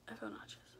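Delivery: hushed groaning whisper of nausea; breathy oh-my-God, stomach aching; pained whisper. Retold or imitated. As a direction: whisper it clutching a sick stomach. Retold nausea.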